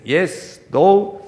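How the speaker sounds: usual speaking voice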